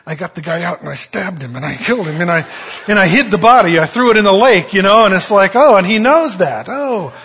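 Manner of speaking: hammed-up guilty confession; mock road rage building to dismay; mock rant. Imagined violent thought, then dismay.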